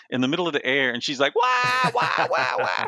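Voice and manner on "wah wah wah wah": very high, loud "wah" cries; mock crying wail. Wife teasing husband who cried while typing, mock wailing. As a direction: wail like a cartoon crybaby, hammed up. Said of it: "she starts acting like she's talking".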